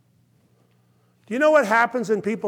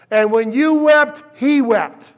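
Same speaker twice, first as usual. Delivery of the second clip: impassioned cry; 6 semitones higher, 8 dB louder than usual. Retold or imitated. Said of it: "I grab them and I say".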